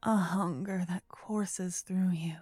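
normal talking voice

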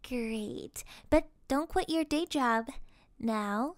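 ordinary delivery

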